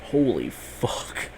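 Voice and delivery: slow, hushed, awed holy fuck; stunned awe. Retold or imitated. Fan recalling the episode's adrenaline, slow hushed awe. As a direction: breathe it out slowly, quietly. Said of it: "It's like".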